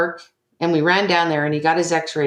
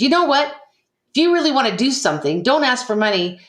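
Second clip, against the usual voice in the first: angry outburst; 6 semitones higher than usual. Retold or imitated. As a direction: snap at him, voice shooting up. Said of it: "So I just was so angry and I said".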